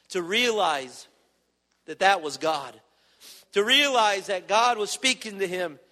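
ordinary talk, nothing marked

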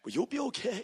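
high, airy brush-off; mocking people brushing off warnings; mock dismissive reassurance. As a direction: wave it away in a mock sing-song. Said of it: "How many times has the bus kids heard that".

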